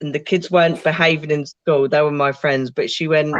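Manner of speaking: usual speaking voice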